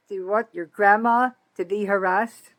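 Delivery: ordinary talk, nothing marked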